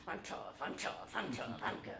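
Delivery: whispered chant under the breath; whispered self-talk. Retold or imitated. Boy in backseat calming himself, whispering. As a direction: whisper it to yourself to stay brave. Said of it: "I'm in the backseat going".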